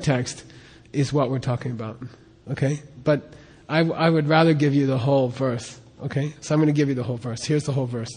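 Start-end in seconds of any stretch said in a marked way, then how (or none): none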